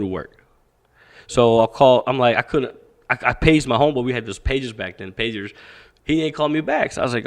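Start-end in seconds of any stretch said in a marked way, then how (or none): none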